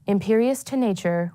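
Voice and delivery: ordinary talk, nothing marked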